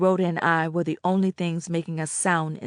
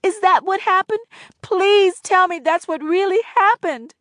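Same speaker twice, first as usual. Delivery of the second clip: put-on much higher, louder pleading girl; 13 semitones higher, 7 dB louder than usual. A narrator's reading. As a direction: beg her to say yes. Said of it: "I pleaded".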